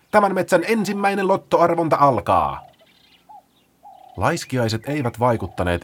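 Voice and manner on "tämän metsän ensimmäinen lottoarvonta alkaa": high, loud showman's proclamation; loud announcement. Acted. Paula proclaims the lottery to the forest. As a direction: announce it like a fairground barker.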